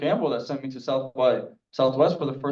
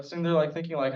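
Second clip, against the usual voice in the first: ordinary delivery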